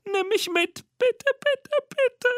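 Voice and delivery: very high squeaky begging; Mimi. A narrator's reading. Little monster begging the girl to take it along, squeaky pleading. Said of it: "ängstlichen Monster mit Piepsstimme".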